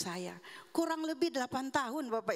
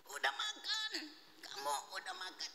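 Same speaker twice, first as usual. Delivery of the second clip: strained, squeezed high voice, breaking up; damaged-voice demo; 7 semitones higher, 7 dB quieter than usual. Shown as a demonstration. Woman showing how she asked "udah makan", strained broken voice. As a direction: force the words out through a failing voice. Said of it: "seperti itu suara saya".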